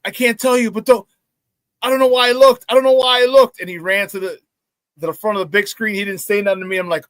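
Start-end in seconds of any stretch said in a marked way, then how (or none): 0.0-3.6 panicky confession. Retold or imitated. Retelling friend's panic after peeking.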